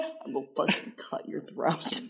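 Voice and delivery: whispered threat. Pretend. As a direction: hiss it under your breath. Hushed mock threat.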